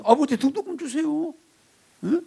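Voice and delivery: put-on high-pitched confident child's request; child asking father. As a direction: ask dad outright in a high voice. Plays child asking father for tuition.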